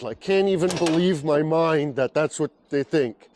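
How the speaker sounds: ordinary talk, nothing marked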